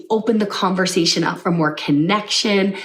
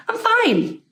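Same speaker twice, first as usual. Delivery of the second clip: much higher, cheery "I'm fine."; bright and upbeat; 13 semitones higher than usual. Shown as a demonstration. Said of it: "Totally different".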